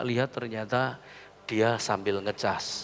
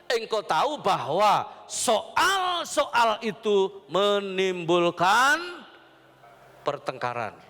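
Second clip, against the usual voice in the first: high, very slow, drawn-out proclamation; declaimed scripture reading; 9 semitones higher, 3 dB louder, much slower than usual. Real, not acted. Preacher reading Timothy to the congregation, declaimed scripture. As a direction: stretch the verse out with rising force.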